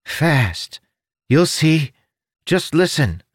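high, odd little creature voice; chirpy winged creature, helpful. Acted.

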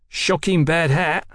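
put-on high, slow boyish taunt; jeering. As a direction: draw out the mockery like a cheeky lad. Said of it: "a wicked boy fleered at him".

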